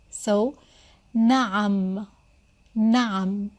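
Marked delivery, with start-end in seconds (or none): 1.2-2.1 نعم with a deep throaty ع in the middle; deep ain sound. Shown as a demonstration. Teacher shows students the deep ع in نعم. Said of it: "number three is a, deep sound a".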